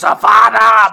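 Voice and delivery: a sudden loud spell cry; mock spell shout. Villain casts a curse, loud hammed-up cry. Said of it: "and he goes".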